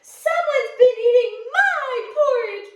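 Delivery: Mama Bear discovering the porridge, high wail. Acted. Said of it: "wailed Mama Bear".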